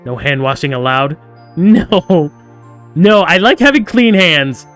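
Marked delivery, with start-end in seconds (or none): 1.6-4.7 high, loud, drawn-out objection; emphatic outburst, playfully outraged; loud protest. Real, not acted. Player objecting to no hand washing, loud protest.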